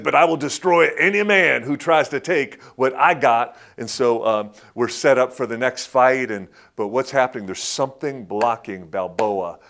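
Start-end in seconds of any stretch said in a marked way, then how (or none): 0.0-3.6 loud, higher menacing boast; mock fighter threat; tough boxer threat. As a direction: bark the threat like Clubber Lang. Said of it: "He says".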